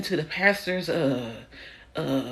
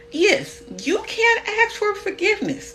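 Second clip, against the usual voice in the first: high mock preaching; 12 semitones higher than usual. Mocking what church folks say.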